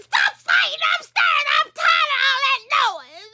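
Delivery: very high, tearful shout; exasperated yell. Acted. Mother yelling at kids fighting upstairs, exasperated. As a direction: holler up at the kids.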